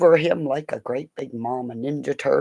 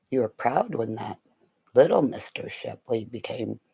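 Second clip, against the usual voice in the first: ordinary talk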